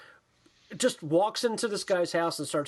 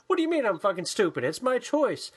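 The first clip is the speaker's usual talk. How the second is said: higher-pitched pretend bickering; indignant, raised-pitch acted spat; mock quarrel; 4 semitones higher than usual. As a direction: argue back in hammed-up indignation. Acted quarrel between the two hosts.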